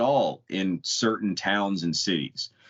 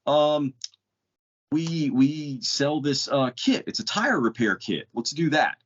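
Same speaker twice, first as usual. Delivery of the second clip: mock-cheerful service clerk, pitched much higher; eager counter guy; 8 semitones higher than usual. Tesla counter clerk offers a repair kit, mock-eager high voice. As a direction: play the clueless clerk proudly offering a fix. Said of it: "The guy behind the counter goes".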